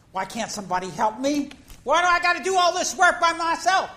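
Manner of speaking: exasperated Martha. Acted. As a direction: cry out overworked and fed up. Martha complaining, voiced by preacher.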